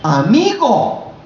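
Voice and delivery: high, drawn-out call of "Amigo"; stretched, rising appeal to a friend. Retold or imitated. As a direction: cry out to a friend, slowly and high. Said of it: "Le dijo".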